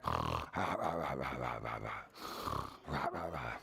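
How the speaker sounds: deep, low snoring breath; slow bear snore. Pretend. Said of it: "Can you sleep like a bear?".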